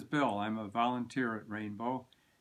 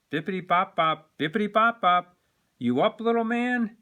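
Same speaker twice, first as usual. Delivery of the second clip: sing-song higher, louder knock rhythm; cheerful father voice doing a secret knock rhythm; playful daddy; 6 semitones higher, 6 dB louder than usual. Acted. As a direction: wake the boy up playfully like a dad. Daddy's secret knock, waking son.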